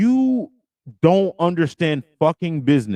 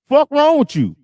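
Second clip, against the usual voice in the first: angry outburst; 11 semitones higher, 3 dB louder than usual. Real, not acted. Host fed up with guest's provider talk, exasperated high outburst. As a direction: pitch jumps way up in exasperation.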